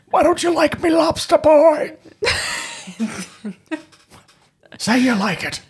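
put-on gruff old fisherman. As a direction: wail it like a weepy old salt. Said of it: "turning a bit into a sailor".

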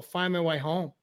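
ordinary talk, nothing marked

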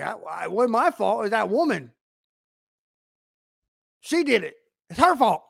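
lightly acted blame-shifting, pitch climbing; Adam making excuses. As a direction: pass the blame, a touch defensive. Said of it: "And Adam was like".

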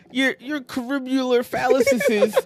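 high, slow put-on raccoon sneer with a laugh breaking in; mock the villain the way the raccoon did; Rocket voice. Friend redoing Rocket's insult to the villain, high and laughing. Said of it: "you gotta say it the way Rocket said it".

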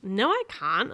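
loud mock shriek. A narrator's reading. Boy denies he is a ninja, loud protesting shriek. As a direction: protest loudly, half shrieking. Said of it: "I shrieked".

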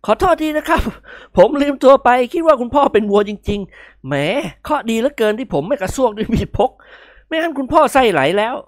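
awkward laughing sorry; sheepish apology. A narrator's reading. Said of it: "นิกรยิ้มแหย่ๆ".

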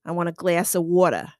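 New Yorker dropping the R in "water"; New York accent. Shown as a demonstration. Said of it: "if you're from New York".

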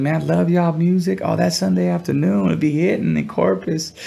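sweet Southern voice, pitched well up. Retold or imitated. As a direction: gush like a friendly Texas girl in a Southern accent. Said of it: "she had that Southern accent".

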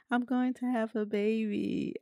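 gently voicing the overjoyed mother-to-be; emotional quote. Retelling the heroine learning she's pregnant, lightly tearful. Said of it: "and she says".

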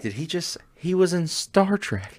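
high, slow disbelieving gasp; mock surprise. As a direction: act stunned that he was in Star Trek. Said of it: "some people are like".

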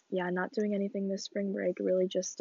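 ordinary delivery

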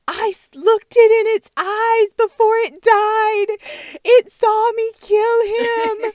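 high, loud, slow wailing sister; mock sobbing scream. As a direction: wail it like she ran over a child. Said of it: "starts screaming about how".

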